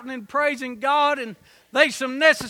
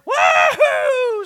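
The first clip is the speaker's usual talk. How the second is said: high whooping rebel yell; 13 semitones higher, 10 dB louder than usual. Retold or imitated. Old rebels cheering, a loud rebel yell. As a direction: let out a wild Confederate whoop. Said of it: "That's the rebel yell".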